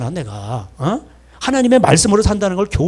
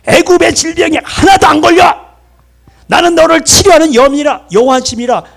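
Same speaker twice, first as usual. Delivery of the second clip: high, loud proclamation; preacher's raised, shouted voice of authority; God; 9 semitones higher, 4 dB louder than usual. Acted. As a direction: declare it as a thundering divine promise. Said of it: "그때 하나님이 말씀을 주시면서".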